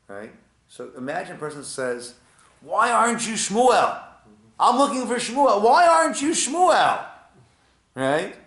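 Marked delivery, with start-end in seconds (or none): 2.6-7.1 high, loud, slow indignant complaint; exasperated demand. Acted. Seeker scolding a stranger for not being Shmuel, loud indignant. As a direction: demand it like he owes you. Seeker annoyed at wrong man.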